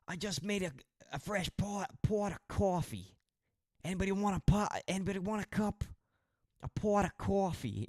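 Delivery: squeaky gas station attendant offering coffee; imitating American guy. Retelling a video of a gas station guy, high mock American voice. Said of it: "this guy walking around a gas station going".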